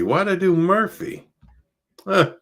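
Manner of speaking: high puzzled exclamation; baffled at his own mistake; surprised. Real, not acted. Noticing his own typo.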